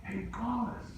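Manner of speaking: lightly voiced girl calling from afar, high and soft; a hint of a girl's friendly call; calling out his name. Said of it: "she calls".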